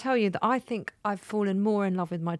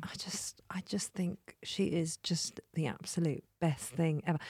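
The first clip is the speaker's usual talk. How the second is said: soft slow tender whisper; 3 semitones lower, 9 dB quieter than usual. Real, not acted. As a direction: hush it like a secret love. Gushing about her dog.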